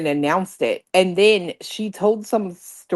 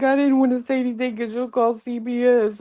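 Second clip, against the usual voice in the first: higher, mock tearful whine; mock crying; 5 semitones higher than usual. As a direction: imitate her fake tears, a bit higher. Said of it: "she starts crying and she's like".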